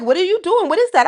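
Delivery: very high, put-on New York accent; incredulous New York questioning, pitched high. Shown as a demonstration. New York cousins questioning her hair, high-pitched accent. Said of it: "give you my, um, New York accent".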